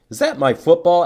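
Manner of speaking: high, loud surprised question; Bob's surprised question. A narrator's reading. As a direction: sound startled to see the ball.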